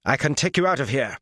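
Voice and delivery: high-pitched, urgent offer, tensely voiced; grated plea. A narrator's reading. Warrior offers the woman a way out, grated. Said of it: "Gawyn grated".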